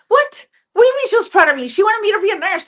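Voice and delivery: high, loud stunned what; retold disbelief. Daughter reacting to mourners at her mom's funeral, stunned and high. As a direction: blurt it in disbelief.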